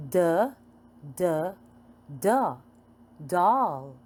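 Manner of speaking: ordinary talk, nothing marked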